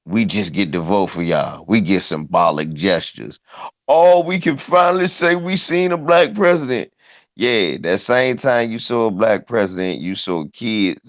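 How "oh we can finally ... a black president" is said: mocking high voice. Mocking a quoted sentiment.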